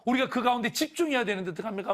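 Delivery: ordinary talk, nothing marked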